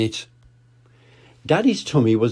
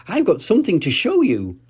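put-on lightly raised little-girl voice; a hint of a young sheep; Susie Sheep; 7 semitones higher than usual. Grandad voicing Susie showing her friend, lightly higher. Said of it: "said Susie".

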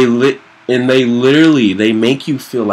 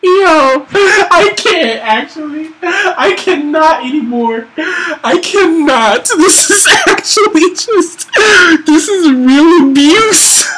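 overwhelmed whine; 4 dB louder than usual. Real, not acted. Overwhelmed by boss.

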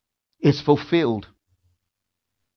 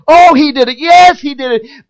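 high, loud jubilant cheer; family celebrating forgiveness, loud and jubilant; family rejoicing; 10 semitones higher, 14 dB louder than usual. Retold or imitated. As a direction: cheer it like the best news ever.

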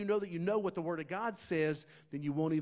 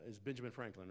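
usual speaking voice

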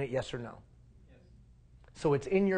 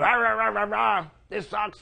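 higher, louder mock grumble rah rah rah; mock ranting; 5 semitones higher, 4 dB louder than usual. Scene: asking if you were rude, mock ranting grumble. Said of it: "Were you".

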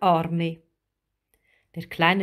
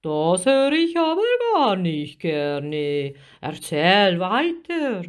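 displeased sea giant urging her on; swooping, stretched giant's voice; pensive giant octopus; 4 dB louder than usual. A narrator's reading. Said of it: "Der Krake kratzte sich nachdenklich".

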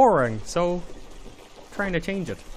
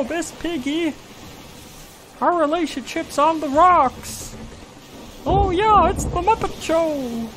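Kermit the Frog; 4 semitones higher than usual. Acted. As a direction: play a woeful puppet frog, pitched up. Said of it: "Kermit sounds better".

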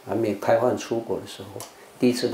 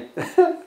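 high burst of laughter. Real, not acted. Amused recalling garbage disposal.

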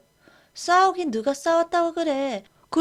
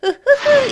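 high smug chuckle; 12 semitones higher, 9 dB louder, slower than usual. Acted. Smug laugh dodging.

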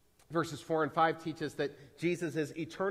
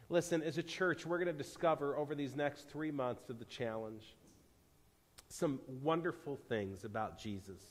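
usual speaking voice

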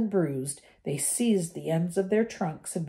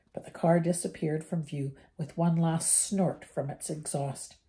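ordinary delivery, nothing marked

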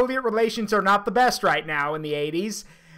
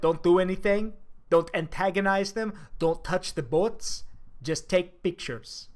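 softer and lower, slowing toward the end; hushed mock orders; 4 semitones lower, 6 dB quieter than usual. Imagined Soviet destroyer crew cruising past, hushed careful orders. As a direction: quietly caution the crew, sinking lower.

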